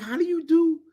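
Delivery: high-pitched playful "how do you do"; mock suave greeting. Cologne wearer greeting people, mock suave. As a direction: pitch it up like a showy introduction. Acts out himself wearing fake cologne.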